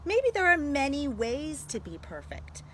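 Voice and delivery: normal talking voice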